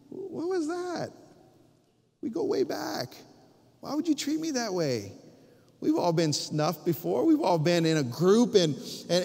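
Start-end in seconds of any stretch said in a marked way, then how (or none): 0.1-5.1 hurt disbelief. Retold or imitated. Voices hurt friend who was denied.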